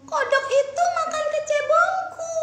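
high, loud tearful complaint; sad. Acted. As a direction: lament a lost pet. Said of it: "kata putri kecil dengan sedih".

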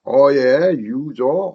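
ordinary talk

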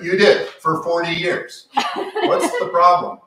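loud, high-pitched comeback in a mock argument; retold retort. Author retelling his comeback to his offended friend, loud retort.

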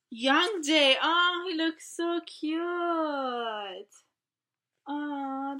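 loud, high thrilled gush; excited cry. Real, not acted. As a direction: gush loudly over the photo. Fangirling over photo.

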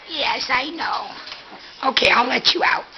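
ordinary delivery

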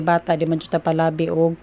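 speaking with food in mouth; mid-bite food review; talking while chewing. Real, not acted. Seen 1: Food put in mouth at -2; jaw keeps moving through the words.

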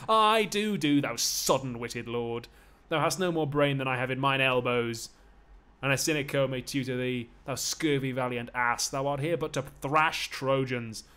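loud, scornful stage railing; full-voiced theatrical taunting; loud goading. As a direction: hurl insults at your master. Slave goads master during beating.

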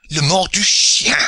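put-on high, indignant French-accented pirate voice; broken English, French accent. A narrator's reading. French pirate protesting his hanging in broken English, high and outraged. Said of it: "he cried in broken English".

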